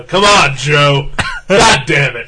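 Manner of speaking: hollered, half-joking frustration; exasperated shout. Pretend. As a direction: yell it in mock exasperation. Mock outburst begging absent friend.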